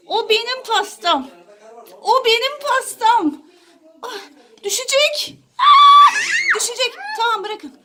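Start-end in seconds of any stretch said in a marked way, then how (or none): 4.0-6.9 high laughing shriek; alarmed laughing cry. Real, not acted. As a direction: cry out as it tips. Cake about to fall.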